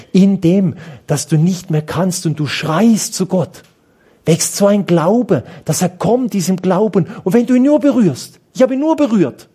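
high, building preacher's call; fervent preaching. Acted. Priest urging the congregation to reach for God, high and fervent. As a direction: drive the faith message home, rising.

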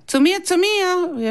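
put-on eager kid yelling for the puck; calling out. A narrator's reading. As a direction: shout to be passed to. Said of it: "ruft der David".

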